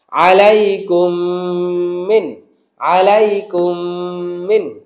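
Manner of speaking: slow recitation with মিন clearly ended. Shown as a demonstration. Teacher modelling clear মিন for students. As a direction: say মিন crisply after a slow আলাইকুম. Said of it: "এটা স্পষ্ট মিন বলাবেন".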